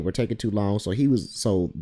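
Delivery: usual speaking voice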